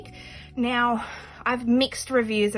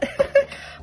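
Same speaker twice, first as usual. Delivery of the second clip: high airy laugh; 6 dB louder than usual. Real, not acted. Laughing on camera.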